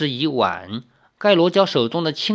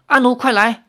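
calling out; 9 semitones higher, 5 dB louder than usual. Acted. Calling to Anu while fleeing.

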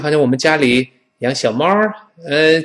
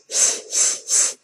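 a voiceless hiss of air between the teeth; soft th hiss; airflow hiss; 8 dB quieter, slower than usual. Shown as a demonstration. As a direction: push air out over the tongue tip. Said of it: "然后气流从上牙和舌尖中间".

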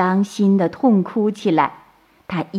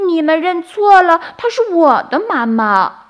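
put-on high, loud, urgent child voice; anxious little crab; 10 semitones higher, 6 dB louder than usual. A narrator's reading. Little crab claims its mother back, anxious protest. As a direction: protest urgently in a small creature's voice. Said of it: "着急地说".

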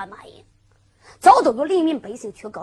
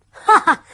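a loud scornful "ha ha", pitched up; hearty laugh; 8 semitones higher, 9 dB louder than usual. Acted. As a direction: laugh off the threat boldly. Zhang Kun laughs at enemy. (豪迈大笑)

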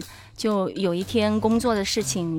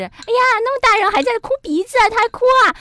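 much higher, fast and mocking; teasing relatives; 13 semitones higher, 6 dB louder, faster than usual. Family members teasing a crying adult relative, higher and fast. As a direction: tease her like relatives laughing at a grown woman crying. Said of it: "最多就是".

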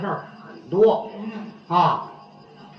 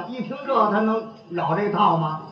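ordinary talk, nothing marked

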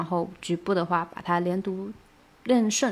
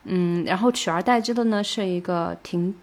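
ordinary talk

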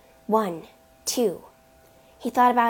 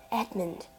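soft, understated sob; subdued, falling plea; sobbed; 8 dB quieter than usual. A narrator's reading. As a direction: say the name softly, a touch tearful. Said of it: "Mercedes sobbed".

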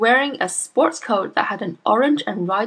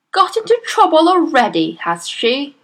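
sneering father; 7 semitones higher, 6 dB louder than usual. A narrator's reading.

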